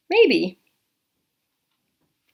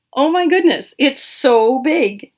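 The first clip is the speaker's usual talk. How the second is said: louder, slow drawn-out amazement; amazed; 4 semitones higher, 6 dB louder, slower than usual. Acted. Reading to a child, marvelling loudly at the giant pumpkin. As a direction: marvel at how big it is, stretching the words.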